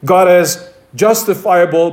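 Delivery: normal talking voice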